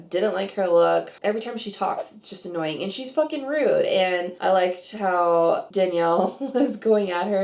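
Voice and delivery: ordinary delivery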